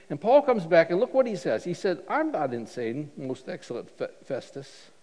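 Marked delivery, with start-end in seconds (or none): 2.1-4.9 respectful. Retold or imitated. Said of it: "he's very respectful".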